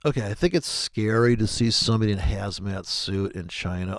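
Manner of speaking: ordinary talk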